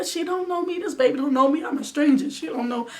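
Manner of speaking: high, loud anxious wail; frantic high-pitched fretting; panicked new mother. Retold or imitated. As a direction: cry out that the baby is a stranger to you. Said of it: "I'm like".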